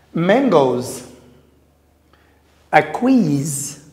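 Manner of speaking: loud, careful teacher reading of singular and plural; read after me drill. Shown as a demonstration. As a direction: call each word out for the class to repeat. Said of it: "tanga na ngai".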